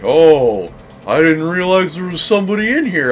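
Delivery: lightly voiced surprised guy at the door; mild mock of the intruder's surprise; awkward stranger. Retelling the guy who walked in, mildly surprised. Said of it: "he's just like".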